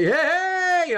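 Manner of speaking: a sudden high yelp of hey, hey; mock subway shout. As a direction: burst out like coming alive on the subway. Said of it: "I'm just like".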